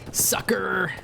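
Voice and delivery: gleeful whispered taunt. Real, not acted. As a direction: gloat over the kill under your breath. Taunting a killed enemy.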